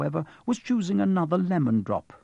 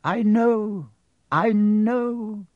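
heavy, drawn-out sympathy; weighty, sorrowful consoling; said heavily; 7 semitones higher, 4 dB louder, much slower than usual. A narrator's reading. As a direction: say it heavily, raised and full-voiced. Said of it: "he said heavily".

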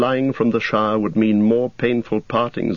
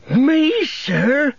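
much higher and louder, thrilled cry; overjoyed Sam; 3 dB louder than usual. A narrator's reading. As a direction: leap at the news like a dog invited for a walk. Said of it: "cried Sam".

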